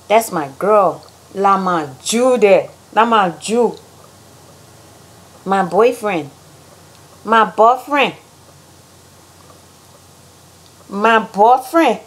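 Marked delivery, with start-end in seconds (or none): 1.3-3.7 Liberian English slang; Liberian accent. Shown as a demonstration. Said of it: "how Liberians say things". 7.2-12.0 put-on Liberian "boyfriend" with a clipped "bɔfɹɛn"; Liberian accent. Shown as a demonstration. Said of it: "how Liberians say things".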